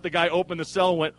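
usual speaking voice

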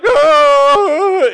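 put-on a child's stunned oh; shocked kids. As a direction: kids seeing their Christmas lists torn up. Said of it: "we looked at each other like".

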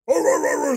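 high wordless mock clamour; imitated ranting. Angry men before walking away, imitated ranting. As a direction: gabble nonsense roars like a brawl breaking up.

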